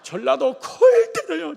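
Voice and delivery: slow, high, loud laughing shout; a preacher laughing at Jeolla folk. Real, not acted. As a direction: laugh it out loud at his own people. Preacher mocks his own region.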